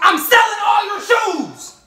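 high, drawn-out shout; loud taunting announcement. Real, not acted. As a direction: yell it slowly and high to provoke. Prankster taunts friend about shoes.

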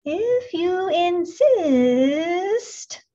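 very slow, high hissing drawl; snake voice. A narrator's reading. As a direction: stretch it out like a snake. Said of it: "said the snake".

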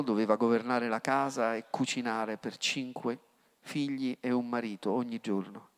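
ordinary delivery

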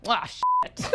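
very high, exaggerated exasperation; retold childhood cursing. Guest shows how the ten-year-old swore, high mock exasperation. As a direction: squeal it like an exasperated kid. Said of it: "I said it with such, like".

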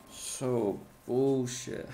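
very slow, stretched-out complaint; drawn-out groan. Real, not acted. Gamer to the game, slow disgusted drawl. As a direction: drag each word out in disgust.

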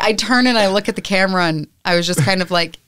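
ordinary talk, nothing marked

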